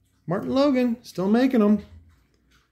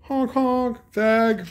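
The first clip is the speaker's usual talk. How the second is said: higher mocking put-on dad voice; imitating dad; 9 semitones higher, much slower than usual. Dad taunting him outside the school, high mocking voice. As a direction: toss it off like a taunting father. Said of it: "and he's like".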